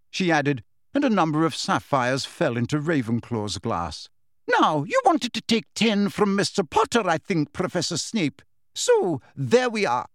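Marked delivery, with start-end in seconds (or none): 4.5-10.1 high, emphatic professor voice; businesslike schoolmistress, pitched up; brisk professor. A narrator's reading. Professor settling points with a colleague, briskly. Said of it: "Professor McGonagall continued briskly".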